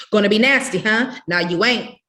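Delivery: loud, stern, punishing; every word a lash; a mother scolding as she whips her daughter. A narrator's reading.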